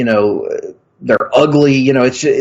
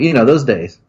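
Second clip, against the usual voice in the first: ordinary delivery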